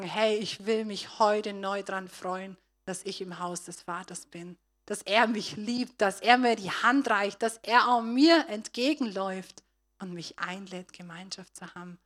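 loud, swelling sermon appeal; fervent preaching. Acted. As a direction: lift the congregation with joy.

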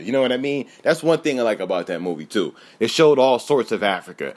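ordinary talk

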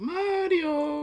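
high slow Mario call. Pretend. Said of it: "I said it like that".

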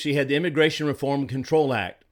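ordinary talk, nothing marked

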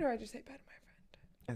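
whispered aside. Real, not acted. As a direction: drop to a breathy whisper. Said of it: "whispered aside".

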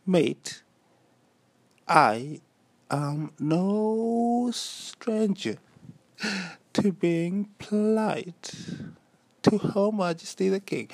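high, drawn-out polite British voice; British accent. Shown as a demonstration. Podcaster showing his polite talk, mock-British accent. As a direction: declare it grandly in a slow British accent. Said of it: "automatically I go into a British accent".

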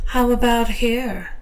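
lightly voiced teasing woman; coy playful question; seductive female voice. Female character voice, higher.

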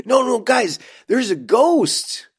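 high, slow, pleading ghost story; whiny insistence like a kid; mock Holden. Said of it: "he's running around in this season going".

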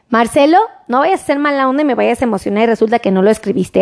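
ordinary talk

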